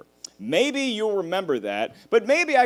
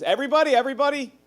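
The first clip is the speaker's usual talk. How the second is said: higher announcement to the family; grandpa calling out; 9 semitones higher than usual. Retold or imitated. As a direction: call the room to order. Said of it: "he says".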